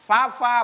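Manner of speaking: "Fa, fa" said wrongly, lips pressed together like "pa". Shown as a demonstration. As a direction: show the mistake.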